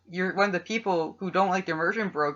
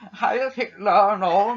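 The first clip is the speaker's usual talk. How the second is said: slow German villain voice with rising Heil; hammed-up German accent; 4 semitones higher, 4 dB louder than usual. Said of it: "I'm gonna do my German accent".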